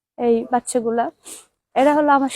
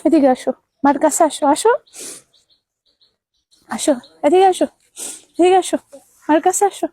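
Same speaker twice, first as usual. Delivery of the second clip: ordinary talk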